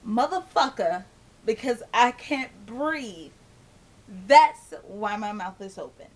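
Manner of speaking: loud, furious swearing at her friend; angry inner outburst. Retold or imitated. Venting at a friend who asked about her open mouth, loud and furious. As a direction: let it rip, fed up and cursing. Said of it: "I wanted to say".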